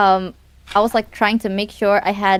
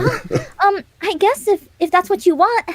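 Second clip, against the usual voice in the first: shy hesitant voice; 10 semitones higher than usual. Retold or imitated. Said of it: "And I was like".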